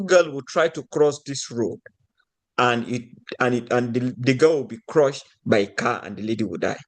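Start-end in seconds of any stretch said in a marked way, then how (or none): none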